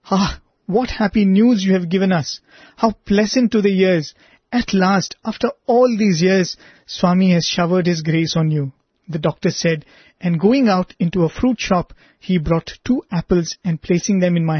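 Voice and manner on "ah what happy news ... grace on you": ordinary delivery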